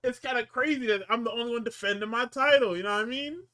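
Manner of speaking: high, defensive whine; whiny Dom. Retold or imitated. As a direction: plead your innocence up high.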